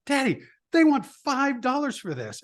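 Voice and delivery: put-on high, whiny kid voice; complaining little boy. Son tells his dad about the price in a high kid's complaint. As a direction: tattle to Daddy about the price. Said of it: "he turned to me and he goes".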